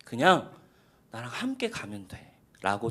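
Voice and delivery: God inviting Abraham, hushed and tender; tender invitation. Retold or imitated. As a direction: coax him gently, like a loving father. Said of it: "초대하시는 거예요".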